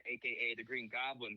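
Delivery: ordinary delivery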